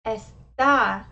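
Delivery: Está punched loudly on the final a; es-TA, loud long last syllable in Está; with the accent. Shown as a demonstration. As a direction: throw the weight onto the end of Está. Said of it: "the word with the accent".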